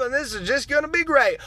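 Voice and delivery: ordinary talk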